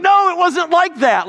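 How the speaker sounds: high, loud earnest protest; emphatic denial. Retold or imitated. Pastor insisting to his old boss, high loud protest.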